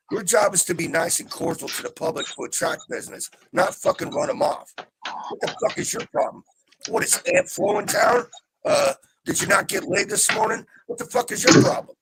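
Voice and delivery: high-pitched, exasperated scolding; indignant tirade. Real, not acted. Customer ranting at an absent store clerk, indignant. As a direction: lay into the rude clerk with mounting disbelief.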